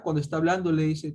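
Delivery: usual speaking voice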